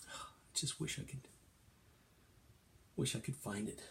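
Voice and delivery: sigh, then very soft, near whisper; hushed, dejected acting; wistful sadness. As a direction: wish it quietly and forlornly. Acted sadness, wishing for toy.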